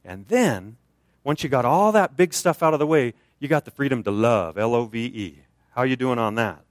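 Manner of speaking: ordinary talk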